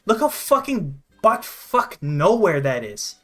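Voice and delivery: usual speaking voice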